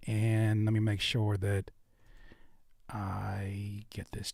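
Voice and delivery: whispered under the breath. Real, not acted. Streamer setting up the chat, whispering to himself. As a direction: mutter it to yourself while fixing the stream.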